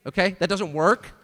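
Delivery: ordinary delivery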